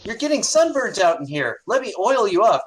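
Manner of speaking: high, swooping, eager offer; fawning gnome girl. Acted. Gnome girl offering sun oil, fawning. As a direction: fuss over them in a girlish pitch. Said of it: "One of them says".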